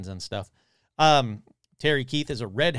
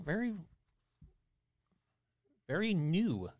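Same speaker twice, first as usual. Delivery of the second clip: usual speaking voice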